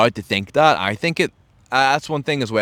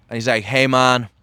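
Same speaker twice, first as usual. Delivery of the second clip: ordinary talk, nothing marked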